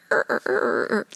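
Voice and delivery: repeated buzzing alarm noise; mock error buzzer; imitated alarm. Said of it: "Like the, the alarm".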